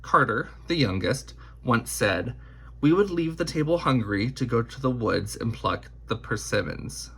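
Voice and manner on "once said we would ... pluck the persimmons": plain reading voice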